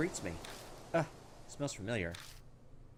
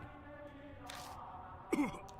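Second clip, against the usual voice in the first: fake cough; 13 semitones higher, 8 dB quieter than usual. Character with bad lungs.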